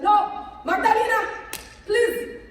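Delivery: loud, high, exasperated father impression; imitated stern father. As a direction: lay down the law as an exasperated father. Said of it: "My father said".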